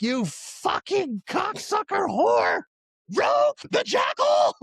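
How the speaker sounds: very high, drawn-out screech of fury; hammed-up outraged shout. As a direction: re-enact cursing out a book villain, slow and shrieking. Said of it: "me reacting like".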